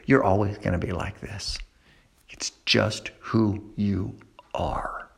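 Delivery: low, hushed accusing whisper; the lie whispered to a rejected teen. Acted. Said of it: "here's what you begin to hear".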